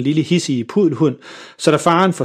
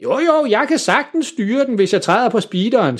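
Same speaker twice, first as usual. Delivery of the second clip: much higher, confident voiced dad; cocky dad; 9 semitones higher than usual. A narrator's reading. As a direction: brush off the kids' worry. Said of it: "siger faren". (en selvsikker far beroliger børnene)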